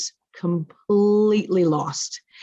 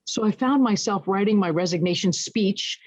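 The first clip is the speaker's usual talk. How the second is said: ordinary talk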